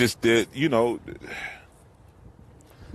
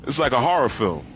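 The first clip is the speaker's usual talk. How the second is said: surprised; 5 semitones higher than usual. Real, not acted. Sees more police boats.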